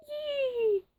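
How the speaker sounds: very high, thin mock shriek; bird's shriek. A narrator's reading. Green bird bursts from a tree, shrieking. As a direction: shriek high like a bird without much volume. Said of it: "with an ear-splitting shriek".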